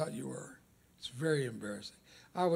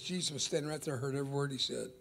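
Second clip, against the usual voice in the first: normal talking voice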